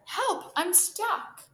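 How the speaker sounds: high, distressed yelp; cry for help. A narrator's reading. Squirrel stuck in a thorn bush, crying out. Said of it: "he cried".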